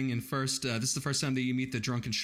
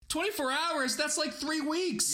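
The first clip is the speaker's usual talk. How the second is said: high-pitched mock outraged townswoman, far higher; lady screaming in protest; slower than usual. Townswoman yelling from the back at the meeting, high-pitched outrage. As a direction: squeal it like a horrified local lady. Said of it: "lady in the back just screaming".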